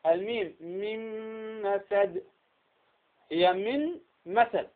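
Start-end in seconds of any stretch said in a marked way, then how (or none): 0.6-2.2 "من مسد" merged as "mimm-masad", long hummed م; Ghunna-Demo. Shown as a demonstration. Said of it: "وكذلك ميم، الميم". 3.5-4.7 "من مسد" slowly in two separate words, ن clearly heard; getrennte Aussprache. Shown as a demonstration. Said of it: "انظر هنا النون".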